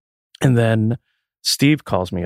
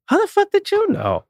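surprised. Retold or imitated. Retelling his reply to sister.

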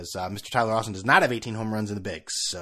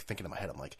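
normal talking voice

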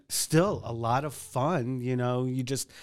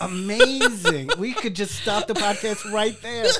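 laughing reaction; 8 semitones higher, 5 dB louder, slower than usual. Real, not acted. Host reacts to divorce attorney punchline.

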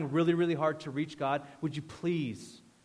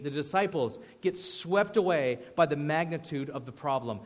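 usual speaking voice

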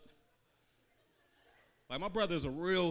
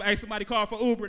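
loud, fast hustling call for riders; hollering out the car window for a fare; mock driver call-out; 5 semitones higher, 10 dB louder, faster than usual. Comic tells crowd, calling out for riders.

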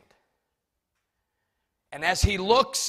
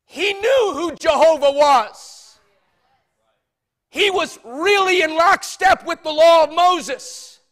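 preaching; 5 semitones higher, 11 dB louder than usual. Acted. Preacher at full force.

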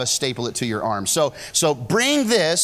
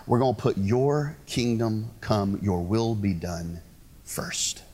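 quiet prayer; 7 semitones lower, 6 dB quieter than usual. Pretend. Prayer voiced to God in sermon.

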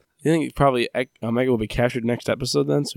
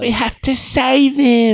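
mock urgent plea; 12 semitones higher, 4 dB louder, much slower than usual. Team member voiced urgently.

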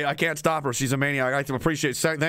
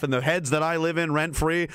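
ordinary delivery

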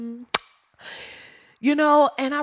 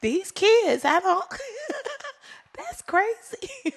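high, breaking into laughter; can't finish for laughing; laughing disbelief; slower than usual. Retold or imitated. Sisters react to the nephew, high and laughing. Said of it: "We was like".

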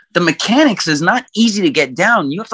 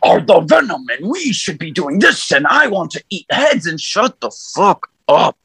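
Venom voice. Retold or imitated. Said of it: "Venom conversation".

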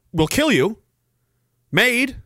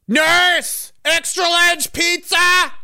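high, slow demanding patient voice; whiny hospice patient; 11 semitones higher, much slower than usual. Pretend. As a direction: play a lazy patient calling nurse.

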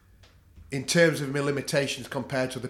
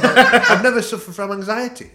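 louder and higher, laughing through the words; 8 semitones higher, 13 dB louder than usual. Real, not acted. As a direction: laugh it out, amused at himself. Repeats claim, laughing second time.

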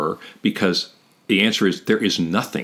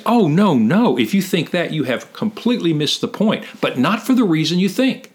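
high, insistent voicing of an objection; animated correction; 8 semitones higher than usual. Paraphrasing Paul correcting a wrong idea, animated. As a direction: pitch it high and wave the mistake away. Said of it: "But what he's saying is".

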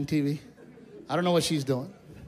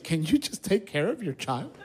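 mock crying wife; 7 semitones higher than usual. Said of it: "my wife crying, she says".

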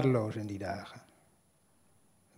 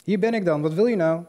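higher, louder challenge up the stairs; calling out; 4 semitones higher, 7 dB louder than usual. Retold or imitated. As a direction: call it up the stairwell, defiant.

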